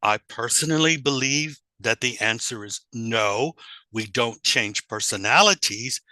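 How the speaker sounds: ordinary delivery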